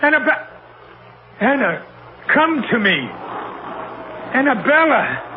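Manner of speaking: calling out. Acted. Captain calls for his dead wife, rising desperate calls. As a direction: call her name into the dark, pleading.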